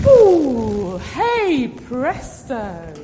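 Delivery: loud, slow showman flourish; kids party magician. Acted. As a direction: draw out the reveal. Trick reveal flourish.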